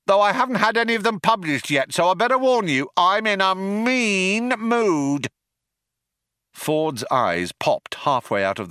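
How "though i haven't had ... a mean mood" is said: the other cop chiming in; second cop chiming in. A narrator's reading. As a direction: chime in brightly.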